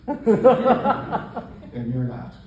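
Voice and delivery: slow, loud, high-stretched "And you're not"; smug jab. Acted. Gloating to the audience that he lives his best life and they don't, slow and loud. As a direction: drag it out smugly at the crowd. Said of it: "smug jab at audience".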